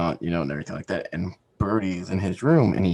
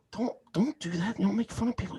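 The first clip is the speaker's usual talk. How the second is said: scolding mom; 12 semitones higher, faster than usual. Retold or imitated. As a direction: play the mother gently telling him off. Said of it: "And Crystal goes".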